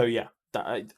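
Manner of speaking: normal talking voice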